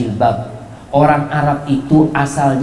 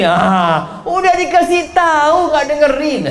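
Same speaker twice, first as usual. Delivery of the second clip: higher, nagging I-told-you-so jab; scornful wife; 11 semitones higher, slower than usual. Pretend. Wife mocking her husband after his business fails, scornful. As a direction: scoff at him for not listening.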